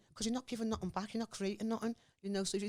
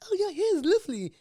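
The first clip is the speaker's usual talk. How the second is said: much higher and louder, gushing mock compliment; 11 semitones higher, 11 dB louder than usual. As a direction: squeal it like a patronising stranger. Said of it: "I still get that in Liverpool".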